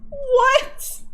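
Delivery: sudden high squeaky what; surprised. Real, not acted. Reacting to chat saying she lags Brazil, startled high. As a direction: genuinely caught off guard. Chatter says she's lagging Brazil.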